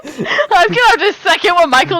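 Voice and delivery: giggling as she agrees; laughing while talking. Real, not acted. Cracking up at his answer.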